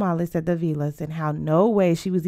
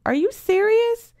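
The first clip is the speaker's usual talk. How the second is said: very high, astonished question; incredulous; 4 dB louder than usual. Acted. Friend reacts to marriage plans, incredulous. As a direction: shoot the pitch way up in disbelief.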